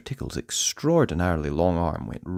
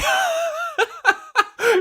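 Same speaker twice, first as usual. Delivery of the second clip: the teacher's shrill laughing fit, much higher. Acted. Teacher being tickled in class, high laughing fit. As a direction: burst into a high helpless laugh. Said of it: "teacher was laughing out loud".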